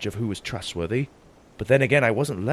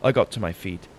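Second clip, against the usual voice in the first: ordinary delivery, nothing marked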